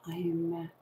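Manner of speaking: ordinary talk, nothing marked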